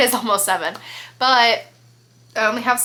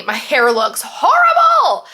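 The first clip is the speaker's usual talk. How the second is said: loud, higher burst of dismay; sudden exclamation; 5 semitones higher, 6 dB louder than usual. Real, not acted. Vlogger suddenly notices her messy hair on camera, loud dismayed exclaim. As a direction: blurt it out, half laughing at yourself.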